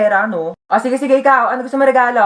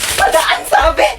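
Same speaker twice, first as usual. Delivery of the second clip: raised, rough-voiced demand; impatient demand; 6 semitones higher, slower than usual. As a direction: push them aside with your voice. Repeated request, losing patience. (naiinis na utos)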